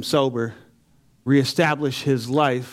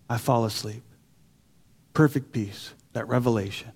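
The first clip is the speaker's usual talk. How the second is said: low, much softer, settled calm; peaceful hush; 6 semitones lower, 7 dB quieter than usual. Real, not acted. Recalling falling asleep after the revelation, hushed and peaceful. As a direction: let it sink into stillness.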